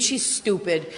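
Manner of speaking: ordinary delivery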